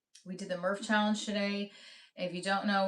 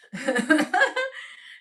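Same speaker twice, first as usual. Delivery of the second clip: laugh; 8 semitones higher, 8 dB louder than usual. Real, not acted. Laughs at own poll question.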